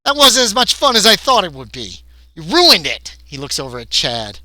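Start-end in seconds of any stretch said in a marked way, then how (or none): 0.0-3.2 high, loud disappointed complaint. Acted. Gray blaming Chad for ruined prank, disappointed. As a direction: whine loudly over a spoiled prank. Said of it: "he looks disappointed and he says".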